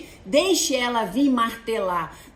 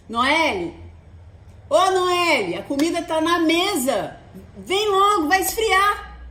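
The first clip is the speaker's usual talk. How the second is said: louder, higher call across the house; 4 semitones higher, 4 dB louder than usual. Retold or imitated. Mother calling daughter to lunch, loud and insistent. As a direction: call her name so it carries.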